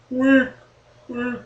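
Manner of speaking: fading echo repeats; self-made echo. Pretend. Host announcing a segment, echoing WEEK in fading repeats. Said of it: "It's an echo usually".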